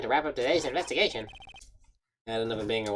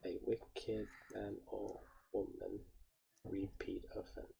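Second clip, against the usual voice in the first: hushed recital; 3 semitones lower, 18 dB quieter than usual. Acted. Rereading haiku, savouring it.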